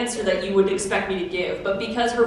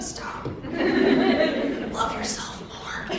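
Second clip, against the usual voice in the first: breathy, wrecked throat, mostly unvoiced; strained voice; 10 semitones higher, slower than usual. Cosplayer mimics her worn-out voice begging her to stop. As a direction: voice giving out, half laughing. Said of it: "your voice is just kind of like".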